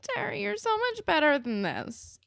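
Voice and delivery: very high, dismayed whine; exasperated plea. Retold or imitated. Viewer pleading with Terry to drop him, exasperated. Speaker acts pleading with character.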